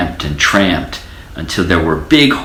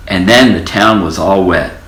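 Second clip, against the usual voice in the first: plain reading voice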